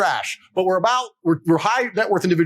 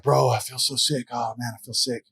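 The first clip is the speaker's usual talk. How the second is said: put-on sick drunk friend; 6 semitones lower, 5 dB quieter than usual. Drunk friend at the gas station, mock sick groan. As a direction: play the hungover mate groaning. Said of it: "One of the guys was like".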